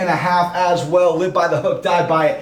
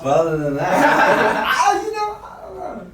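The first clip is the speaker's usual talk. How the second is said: laughing; 4 semitones higher than usual. Real, not acted. Laughs while hedging his pick.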